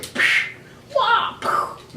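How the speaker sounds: high, airy mock martial-arts sound effects; ninja kick noises. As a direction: imitate kick whooshes and a tiny yelp. Imitating toy ninja kicks.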